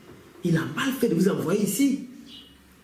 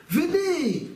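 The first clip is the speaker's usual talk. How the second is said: high, drawn-out beckoning; coaxing invitation; 11 semitones higher than usual. Acted. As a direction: call her over on a swooping high note. Officer beckons the wife to him.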